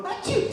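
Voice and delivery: a quick high fake sneeze; mock sneeze. Telling the crowd about the sneeze, mock sneeze. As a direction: act out a little sneeze. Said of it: "and then you go".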